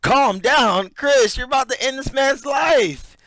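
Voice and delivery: loud, high disbelieving yell; real outrage at the host's calm; incredulous shout. Real, not acted.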